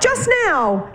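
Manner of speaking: high, emphatic retort; indignant. Acted. Wife answering her doubting husband, indignant. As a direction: throw it back high and cross.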